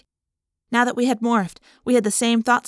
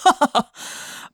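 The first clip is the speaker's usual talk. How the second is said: cruel laugh; 6 semitones higher than usual. A narrator's reading. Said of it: "I heard Chapman laugh cruelly".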